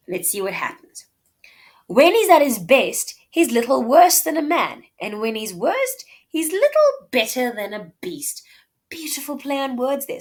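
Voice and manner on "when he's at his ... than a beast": lively reading. Acted. Reads Portia's witty insult.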